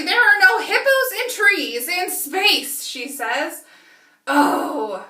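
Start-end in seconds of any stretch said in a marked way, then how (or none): 0.0-2.8 loud, higher, slow protest; upset. Acted. Lila protesting about the hippo, loud slow complaint. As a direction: complain it makes no sense. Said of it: "she's super having a hard time".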